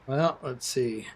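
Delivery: slow, soft words through a yawn. Real, not acted. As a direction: yawn while you talk. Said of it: "that yawn is a reminder".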